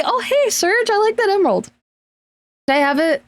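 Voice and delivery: character abruptly brightening to ask Serge for his emerald; sudden cheerful switch. Shown as a demonstration. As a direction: snap out of the tears and ask for the emerald. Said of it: "switch up voices really quickly".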